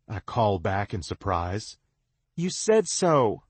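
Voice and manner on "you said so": impatient press. A narrator's reading. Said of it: "she presses impatiently".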